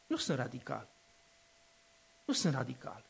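calm denial. Real, not acted. Preacher answering the charge of being radical, soft and low. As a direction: quietly insist you are not radical. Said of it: "Ești cam, poate cam radical".

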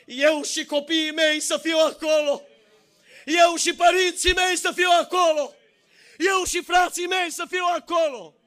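high, loud preaching at full force; fiery preaching. Acted. Preacher urging parents to save their households, full-force preaching. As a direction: thunder it from the pulpit, rising to shouts.

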